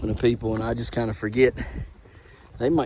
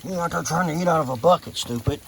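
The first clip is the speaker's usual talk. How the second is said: higher, quick chiding of a goat; teasing scold; 6 semitones higher, faster than usual. Real, not acted. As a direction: chide the silly animal in a raised pitch.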